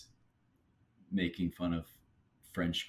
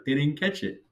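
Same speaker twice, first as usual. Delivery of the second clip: imitating Charles after a pause; 7 semitones higher than usual. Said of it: "He had a little pause".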